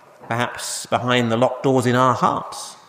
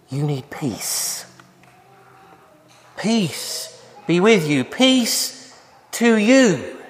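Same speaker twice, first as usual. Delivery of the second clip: gentle reassurance; 4 semitones higher, much slower than usual. Acted. Jesus calming frightened disciples, slow and gentle. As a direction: offer peace tenderly, slowly. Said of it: "And Jesus comes and says".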